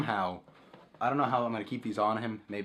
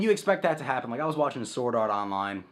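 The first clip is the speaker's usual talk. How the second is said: usual speaking voice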